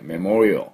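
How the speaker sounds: メモリアル as "meh-MOR-ee-uhl"; メモリアル in English, swelling on the second syllable; correct English pronunciation. Shown as a demonstration. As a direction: stress "MOR" in メモリアル and end on a dark l. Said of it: "最後にUをつけない".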